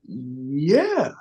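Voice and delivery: long, drawn-out, unsure yeah; hesitant agreement. Retold or imitated. Actor asked for a Jamaican accent, hesitant. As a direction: stretch it slowly as if bluffing.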